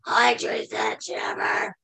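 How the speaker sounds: croaky sick voice, trailing off; sick hoarse voice. Retold or imitated. Calling the boss's secretary, sounding sick and croaky. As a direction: sound ill calling in to work. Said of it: "I sounded like this".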